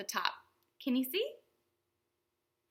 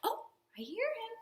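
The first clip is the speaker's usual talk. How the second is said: mock surprised whisper; 9 semitones higher, 6 dB quieter than usual. Pretending friend arrives.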